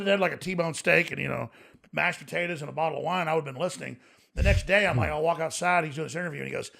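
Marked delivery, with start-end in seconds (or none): none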